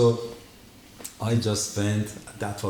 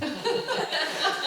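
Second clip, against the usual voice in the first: breathy laugh. Real, not acted. Laughing at his own story.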